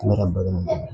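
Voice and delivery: teacher murmuring a greeting down a string phone; soft into string phone. Shown as a demonstration. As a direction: speak softly so only the listener hears. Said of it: "आराम से बोलना".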